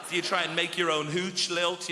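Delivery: ordinary delivery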